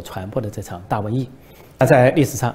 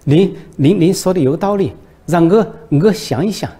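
put-on flustered Shaanxi accent, much higher and louder, stammering; Shaanxi dialect; 7 semitones higher, 8 dB louder than usual. Xi, panicked by the prison warning, blurts in Shaanxi dialect. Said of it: "急得蹦出了陕西话".

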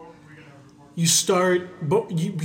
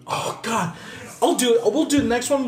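excited; 6 semitones higher than usual. Real, not acted. Excited reaction to Montucky.

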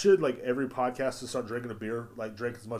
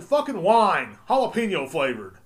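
loud, higher mock demand; mock shout; 5 semitones higher, 10 dB louder than usual. Telling Taco Bell what's missing, mock loud demand. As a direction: belt out the silly order. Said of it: "I said".

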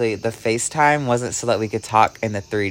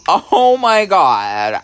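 higher, louder, slow "oh my God"; exclaiming; 9 semitones higher, 8 dB louder, much slower than usual. Real, not acted. Reliving the reveal that the demon was her twin flame, loud slow exclaim. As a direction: stretch it out in shock.

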